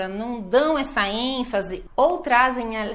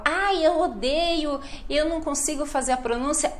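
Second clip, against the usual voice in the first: complaining student; 5 semitones higher than usual.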